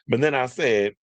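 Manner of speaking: ordinary talk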